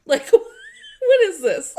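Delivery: high, loud, laughing disbelief; mock incredulous. Actress asking co-star about odd chair, mock incredulous. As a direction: stretch it out loudly in imitated bafflement.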